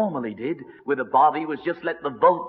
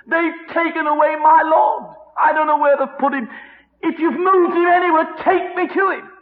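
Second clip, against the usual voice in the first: cry of a broken heart; 12 semitones higher, 7 dB louder than usual. Acted. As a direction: beg the gardener through tears. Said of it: "that's the cry of a broken heart".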